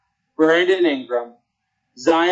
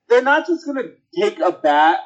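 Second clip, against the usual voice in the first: ordinary talk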